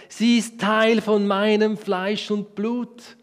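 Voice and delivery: langsam, höher und lauter, feierlich; ergriffener Ausruf. Acted. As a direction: jedes Wort voller Staunen dehnen. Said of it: "rief Adam aus". (Adam spricht langsam und ergriffen über Eva)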